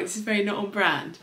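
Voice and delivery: ordinary talk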